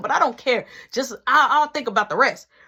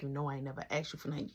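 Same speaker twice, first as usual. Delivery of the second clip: quiet fast plea; 9 semitones lower, 16 dB quieter, much faster than usual. Retold or imitated. Pleading fast hush.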